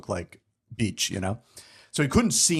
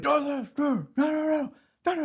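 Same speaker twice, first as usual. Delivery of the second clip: yelling no. Retold or imitated. Director yelling behind the door.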